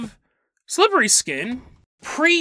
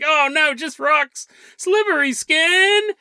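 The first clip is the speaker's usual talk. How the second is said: dismayed discovery; 7 semitones higher, 3 dB louder, slower than usual. Retold or imitated. Farmer finds rocks in bucket.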